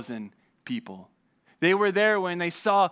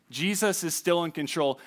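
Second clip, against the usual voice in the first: ordinary delivery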